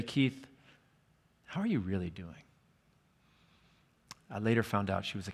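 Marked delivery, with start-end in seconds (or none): 0.0-2.4 quieter and lower, asking gently; soft, probing question. Retold or imitated. Counselor gently presses a teen on how he is, softly. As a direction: lean in and ask it softly, meaning it. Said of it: "And then she would say".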